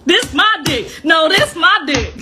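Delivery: high-pitched imitation of a squabble; mock quarrel. Mocking overheard women's fight, laughing after.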